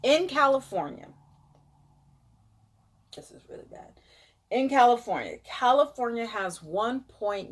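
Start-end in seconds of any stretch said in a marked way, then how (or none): 3.2-3.9 whispered dismay; hushed worried whisper. Real, not acted. Reacting to California numbers.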